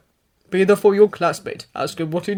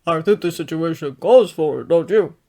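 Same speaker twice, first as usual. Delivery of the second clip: gentle; 2 semitones lower, 6 dB louder than usual. A narrator's reading. All Might gently reassuring his crying student. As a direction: soothe him gently. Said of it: "All Might says gently".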